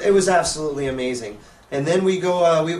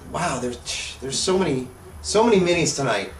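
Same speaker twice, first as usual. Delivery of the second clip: ordinary talk, nothing marked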